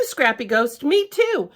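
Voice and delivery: usual speaking voice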